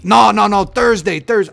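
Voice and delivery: imitating the clerk insisting on Thursday; mock accented clerk. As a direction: insist like the annoyed shop clerk. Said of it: "they yell at you".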